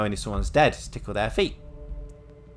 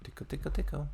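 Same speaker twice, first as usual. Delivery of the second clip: playful tickling; 7 semitones higher, 6 dB quieter than usual. Pretend. Playfully tickling a corpse's feet.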